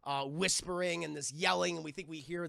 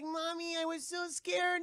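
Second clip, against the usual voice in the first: put-on high, slow whimpering child voice; little girl; 10 semitones higher, slower than usual. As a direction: imitate a scared kid telling mommy. Rescued child speaks to mother.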